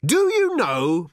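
slow, loud and high; sarcastic bellow. A narrator's reading. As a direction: boom it out in exasperation. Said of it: "bellowed Arthur".

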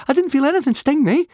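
fast, very high and loud; eager. A narrator's reading. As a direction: rush out an eager high-pitched denial. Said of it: "Fishlegs said eagerly".

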